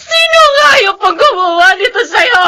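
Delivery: crying shout rising high on the last word; tearful demand. Acted. Grieving mother demanding who did this, crying shout. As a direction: demand the killer's name through tears.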